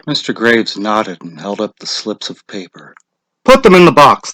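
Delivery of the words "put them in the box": louder, higher instruction; directing. Acted. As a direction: direct them firmly. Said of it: "Mister Summers directed".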